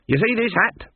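high, pointed demand. A narrator's reading. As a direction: press him with the question. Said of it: "she demanded".

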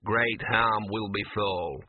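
slow gruff dog mutter, echoing her words; a small dog repeating a grim warning, muttering. A narrator's reading. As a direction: grumble it back under the breath. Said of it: "muttered Gaspode".

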